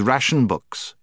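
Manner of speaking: plain reading voice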